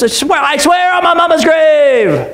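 acted shouted oath; hammed-up vehement oath; emphatic swearing. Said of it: "he adds a swearing to it".

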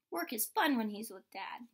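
ordinary delivery

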